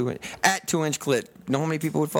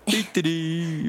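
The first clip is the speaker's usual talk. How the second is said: mocking voice; 3 semitones higher, much slower than usual.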